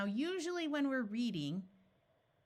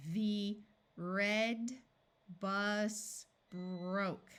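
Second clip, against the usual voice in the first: ordinary talk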